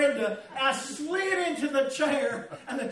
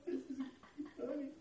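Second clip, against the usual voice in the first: much softer, snickering through the words; a hushed snigger at the fun; 17 dB quieter than usual. Retold or imitated. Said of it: "I was still snickering".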